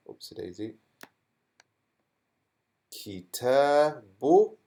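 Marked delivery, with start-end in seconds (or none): 2.9-4.6 slow, drawn-out kitabu with a rising end; slow reading. Shown as a demonstration. Teacher sounding out the word without tanween. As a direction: sound out "Kitabu." slowly, no final n. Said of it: "How would I read this now".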